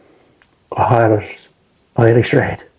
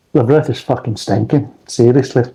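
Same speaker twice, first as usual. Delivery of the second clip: ordinary talk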